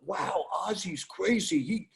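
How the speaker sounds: gushing mock amazement; mock awe. Voicing the awed public.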